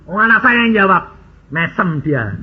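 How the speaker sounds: normal talking voice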